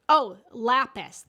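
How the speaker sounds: loud, relieved "Oh, lapis."; sudden recall. Real, not acted. Finally remembering the crystal, loud eureka.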